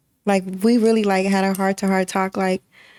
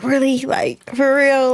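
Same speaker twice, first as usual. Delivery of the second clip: mock surprise; 6 semitones higher, slower than usual. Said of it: "He was like".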